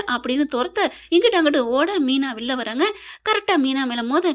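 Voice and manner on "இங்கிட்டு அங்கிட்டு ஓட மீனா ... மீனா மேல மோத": ordinary delivery, nothing marked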